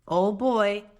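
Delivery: ordinary delivery, nothing marked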